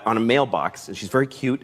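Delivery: ordinary talk, nothing marked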